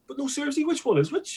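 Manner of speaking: mock a clueless customer who insists; mocking insistent question. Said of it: "he was, like, going".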